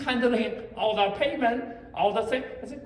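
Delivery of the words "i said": ordinary talk, nothing marked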